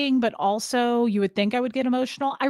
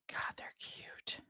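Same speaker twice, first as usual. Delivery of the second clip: soft unvoiced gush; real whisper; 23 dB quieter, slower than usual. Real, not acted. Whispering to herself over her nephews' photos, smitten. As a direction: whisper it, overwhelmed by cuteness.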